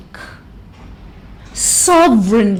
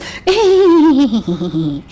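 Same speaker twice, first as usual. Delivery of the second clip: small restrained higher giggle; covered polite giggle; 4 semitones higher than usual. Shown as a demonstration. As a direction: giggle holding back the teeth. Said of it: "women ... laugh like this".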